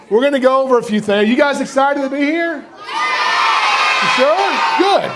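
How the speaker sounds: hyping the crowd. Real, not acted. Presenter hyping kids before the show, excited.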